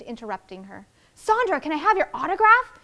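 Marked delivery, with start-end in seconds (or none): 1.1-2.8 high, loud fan's plea; excited call. Acted. Neighbor calling to the star for an autograph, high and loud. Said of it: "Missus Martinez called out".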